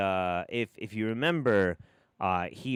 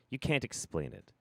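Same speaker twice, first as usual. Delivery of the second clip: ordinary talk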